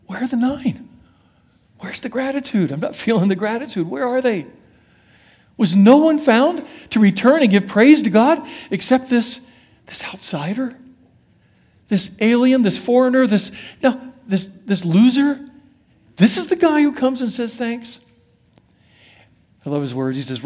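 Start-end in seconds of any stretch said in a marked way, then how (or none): 0.1-15.4 higher, incredulous, asking where the others went; stunned that only the outsider came back; startled indignation. Retold or imitated. Jesus asking crowd where the nine lepers went, startled and indignant. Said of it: "This is how we know Jesus was startled". 16.2-18.0 incredulous about the one who came back; startled emphasis. Retold or imitated. Jesus marveling at the grateful outsider, higher and incredulous. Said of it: "Jesus was startled".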